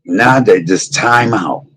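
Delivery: ordinary delivery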